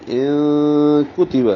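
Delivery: slow ان کتب, n hidden in a long nasal hum; hidden noon, ikhfa. Shown as a demonstration. Teacher showing ikhfa, ان held as a nasal hum. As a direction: hum through the n of ان before کتب. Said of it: "نون کی آواز کو چھپائیں گے".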